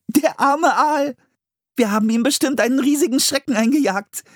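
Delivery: put-on a girl still giggling over the poor eel; girl voice, laughing pity. A narrator's reading. Anne to her brother after falling in, high and amused.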